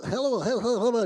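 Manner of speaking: high, loud imitation of a code-switched office greeting; mock code-switching voice; work voice. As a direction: put on a chirpy work hello. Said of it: "We be at work and shit. We just be like".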